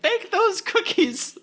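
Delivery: very high, loud, drawn-out imitation; grandpa's mock yell. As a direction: call it out slowly as a comic grandpa. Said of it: "and he's like".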